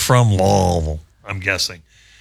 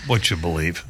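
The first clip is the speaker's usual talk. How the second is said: ordinary talk, nothing marked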